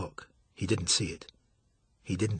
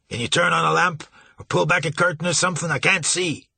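raised voice; 6 semitones higher, 5 dB louder than usual. Acted. Marsh blind, pleading loudly.

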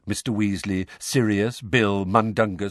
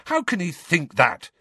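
mildly indignant outburst; angrily; 9 semitones higher than usual. A narrator's reading. Harry protesting to the Order, mildly angry. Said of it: "said Harry angrily".

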